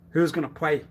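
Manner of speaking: ordinary talk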